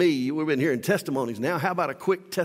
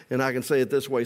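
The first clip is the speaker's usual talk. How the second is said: normal talking voice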